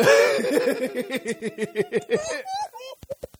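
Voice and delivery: high wheezing laugh; cracking up hard; loud laughter. Real, not acted.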